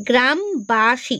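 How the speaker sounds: "গ্রামবাসী" slowly as গ্রাম, বা, সী; broken into syllables. Shown as a demonstration. Teacher recapping the split, "গ্রামবাসী" syllable by syllable. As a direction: pause between the three syllables of "গ্রামবাসী". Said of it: "এরকম ভাবে যদি ভাঙি".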